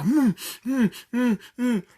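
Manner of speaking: high mm sounds of someone eating; imitating a lady stuffing candy in her mouth; mock munching hums. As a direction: hum through a mouthful of candy. Lady eats candy greedily.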